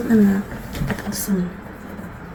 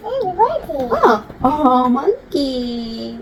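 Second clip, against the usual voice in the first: slow, drawn out; 3 dB quieter, much slower than usual. Shown as a demonstration. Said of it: "Can you say monkey?".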